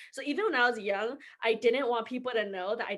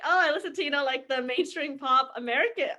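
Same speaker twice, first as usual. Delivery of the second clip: fake casual; 4 semitones higher, 5 dB louder than usual. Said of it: "I was like".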